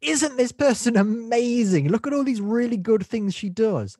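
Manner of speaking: high, loud mock gushing; hammed-up amazement, stretched and over-enthusiastic; gushing praise. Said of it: "it was a lot of".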